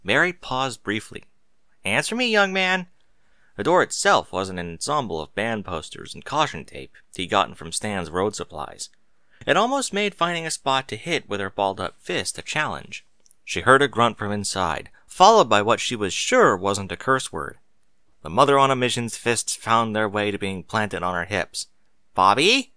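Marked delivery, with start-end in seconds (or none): 1.8-2.9 high stern mother voice; stern scolding. Acted. Mother scolding through door. 22.1-22.7 raised, rising call through a door. Acted. Mother calling through door.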